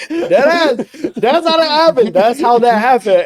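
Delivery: high, loud, excited Kevin Hart squeal. Retold or imitated. Retelling the Takis story, bursting into Kevin Hart voice. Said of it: "So that's how the Kevin Hart boy".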